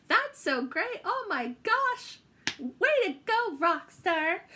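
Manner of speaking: gushing teacher. Retold or imitated. Voicing the teacher's encouraging replies to her notes. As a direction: cheer like a proud teacher.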